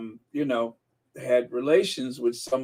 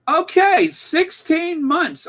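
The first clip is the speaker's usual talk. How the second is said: high, loud, stretched-out cheer; excited shout-out; 6 dB louder, slower than usual. Real, not acted. Host spots a member's sixteen-month milestone, excited shout-out. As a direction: announce it high and loud, drawing out the words. Delighted at spotting a long membership.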